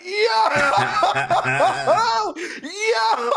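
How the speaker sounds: very high, loud screaming joy; hyped shout. Retold or imitated. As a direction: yell it like you just opened the box. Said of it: "I was like".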